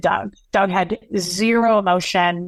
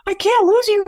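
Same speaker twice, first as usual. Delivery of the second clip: very high, louder crying plea; imitating a desperate wail; 13 semitones higher, 4 dB louder than usual. Son pleading with grandfather not to leave, high wailing cry. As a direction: wail it out like a breaking kid. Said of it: "it was so heart-wrenching".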